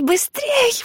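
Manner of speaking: very high urgent cry; urgent shout. A narrator's reading. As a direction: yell at her to run. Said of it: "вдруг закричала Фрэнсис".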